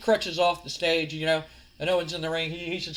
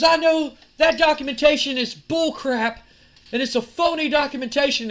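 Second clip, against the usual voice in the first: heated accusation; 8 semitones higher, 7 dB louder than usual. Acted. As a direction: call out a fraud in a wrestling promo. Acted accusation in a quarrel.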